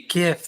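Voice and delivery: GIF with a hard G; GIF opening on a hard g. Shown as a demonstration. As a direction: say GIF with the G of "gift". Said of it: "That sounds so".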